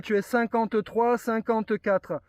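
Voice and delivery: ordinary talk, nothing marked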